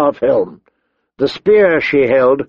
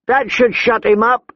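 high-pitched scornful remark; vicious woman brigand; 10 semitones higher than usual. A narrator's reading. Short woman to gang about boy, scornful. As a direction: finish the taunt in a high voice.

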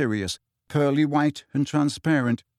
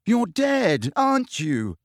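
high, exasperated question; frustrated, pitch jumping high; 8 semitones higher, slower than usual. A narrator's reading. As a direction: throw up the obvious, fed up. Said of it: "said Harry exasperatedly".

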